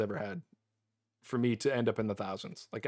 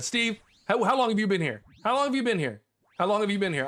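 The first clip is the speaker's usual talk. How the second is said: higher-pitched, insistent questioning; excited questioning; 6 semitones higher, faster than usual. Real, not acted. Streamer asks viewer Steve about the luck, excited questioning. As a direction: press eagerly for an answer.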